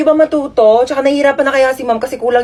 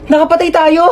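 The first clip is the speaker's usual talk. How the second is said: raised-pitch panicked exclamation; alarmed cry; 4 semitones higher than usual. Acted. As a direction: let panic start to rise. Realising they have killed someone.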